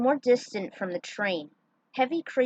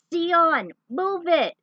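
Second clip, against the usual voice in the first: loud, higher shout across the station; urgent call; 8 semitones higher, 8 dB louder, much slower than usual. Acted. Volt shouting to Xion to reach the train, loud and higher. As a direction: call him to hurry.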